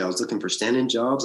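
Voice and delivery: ordinary talk, nothing marked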